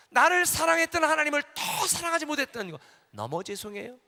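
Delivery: tearful repentant cry. Retold or imitated. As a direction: pour out repentance as a loud wailing plea. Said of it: "얼마나 회개를 했는지".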